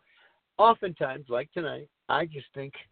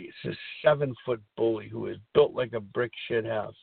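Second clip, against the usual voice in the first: normal talking voice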